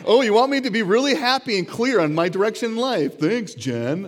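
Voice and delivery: loud, high mock gratitude; exaggerated scornful enthusiasm; sarcastic suspicion. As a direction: feign delight, dripping with sarcasm. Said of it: "I was very suspicious of this".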